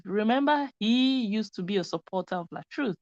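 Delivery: normal talking voice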